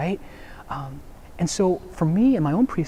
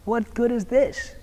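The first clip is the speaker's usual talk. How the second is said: pitched-up, drawn-out disbelieving question; mild mock complaint, pitched up; indignant man in the hole; 9 semitones higher, slower than usual. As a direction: play the stuck man scoffing at the prescription, higher. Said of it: "the guy in the hole is like".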